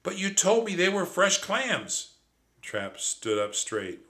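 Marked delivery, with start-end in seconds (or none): 0.0-2.1 high, louder indignant protest. Acted. Narrator protests to Trap about clams, high indignant. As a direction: protest in disbelief.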